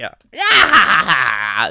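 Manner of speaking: loud screaming laughter; shrieking laugh. Real, not acted. Streamer cracking up loudly after the ghast fight. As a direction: laugh out loud half shouting.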